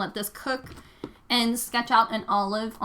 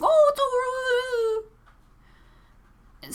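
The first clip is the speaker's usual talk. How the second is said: super enthusiastic; 14 semitones higher, faster than usual. Retold or imitated. Dog owner dismissing the walker's warning, super enthusiastic. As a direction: imitate a carefree stranger, pitched way up. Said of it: "he was, like, super enthusiastic".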